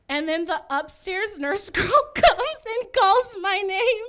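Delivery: comic mock distress. Comic telling a crowd about being called by nurse, mock-distressed. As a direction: voice climbing high, slowly, tearful wobble.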